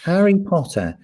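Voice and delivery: high, lofty posh British voice; posh English accent. Retold or imitated. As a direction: say it like a posh Hogwarts boy. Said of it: "Nobody speaks like that".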